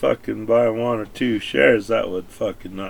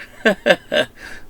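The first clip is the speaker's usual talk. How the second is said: a quick high burst of laughter, amused by his own joke; short real laugh; 8 semitones higher than usual. Real, not acted.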